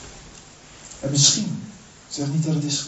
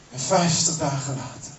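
ordinary delivery

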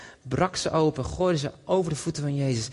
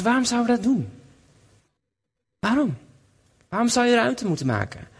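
ordinary talk, nothing marked

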